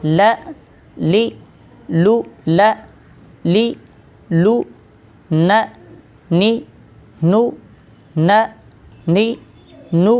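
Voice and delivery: ल ली लू, न नी नू, र री रू each said alone with pauses; practice drill syllables. Shown as a demonstration. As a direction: drill the three tongue-tip letters syllable by syllable. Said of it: "इन तीनों हरूफ की प्रैक्टिस करते हैं".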